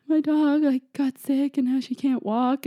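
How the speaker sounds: high, choked-up retold plea; imitating herself struggling to explain; barely able to talk. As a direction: squeeze it out high, as if near tears. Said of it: "I could barely talk".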